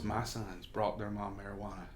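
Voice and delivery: hushed, as if afraid to be overheard; low, like nobody's around. Retold or imitated. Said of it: "starts talking, like, low, like nobody's around".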